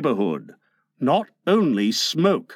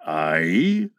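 very low pirate captain; Captain Hook; 10 semitones lower than usual. A narrator's reading. Hook agrees with Smee, deep captain's voice. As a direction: answer deep in the voice.

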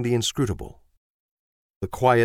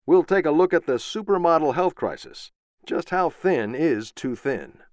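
higher news anchor cadence; TV news read; 6 semitones higher than usual. Acted. TV anchor reading the morning headlines.

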